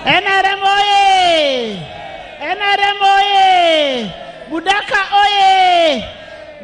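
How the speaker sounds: slow, high rally chant shouted out; roaring rallying speech. Real, not acted. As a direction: rouse the crowd with a drawn-out cry. Rally crowd call and response.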